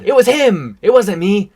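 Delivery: loud high protesting; defensive shouting. Acted. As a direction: shout the blame away. Mock defensive denial.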